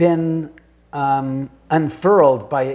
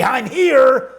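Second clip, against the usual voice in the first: high, louder sing-song "I'm here"; mock burglar announcing himself; 7 semitones higher, 3 dB louder than usual. Burglar knocking to announce himself, mock call. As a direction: play a burglar calling out cheerfully. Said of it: "knock, knock to say".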